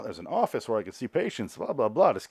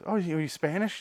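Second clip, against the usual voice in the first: higher, nosy put-on voice; mock Marcy the realtor; 8 semitones higher than usual. Realtor Marcy quizzing the Ramos family, higher nosy mock voice. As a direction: pitch it up like a prying agent. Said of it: "She goes".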